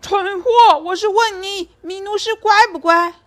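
loud, high furious lion; lion loses his temper with the bear, furious. Acted. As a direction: explode at the old bear. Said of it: "狮子火了".

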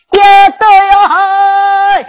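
long, stretched-out high call; drawn-out call. Acted. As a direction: hold the call out like a herald. 就听军兵高喊.

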